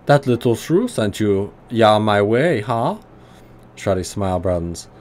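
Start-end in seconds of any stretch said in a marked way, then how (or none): 0.1-3.0 put-on gruff German accent, pitched higher. Old tinkerer greeting the player in a higher gruff German accent. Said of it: "heavily accented German tonality".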